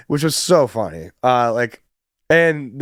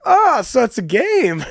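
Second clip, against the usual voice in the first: high-pitched, hammed-up British accent; a delighted realisation in bad British; Mike Wallace; 11 semitones higher than usual. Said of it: "like, my horrible British accent".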